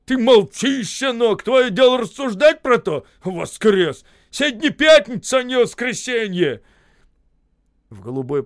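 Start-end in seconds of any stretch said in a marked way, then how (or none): 0.0-3.0 high, loud, scolding roar; furious yell. A narrator's reading. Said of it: "заорал на него". 3.2-4.0 Воскрес! flung back loud and falling; scornful loud Воскрес; mocking shout. A narrator's reading. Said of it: "заорал на него". 4.3-6.6 high, loud, scolding roar; furious yell. A narrator's reading. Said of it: "заорал на него".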